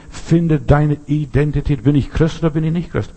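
normal talking voice

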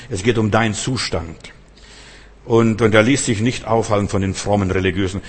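usual speaking voice

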